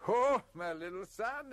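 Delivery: high, loud burst of delight; laughing joy. A narrator's reading. New father greeting his baby son, joyful cry. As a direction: cry out in sudden elation. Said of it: "suddenly began laughing like a calf".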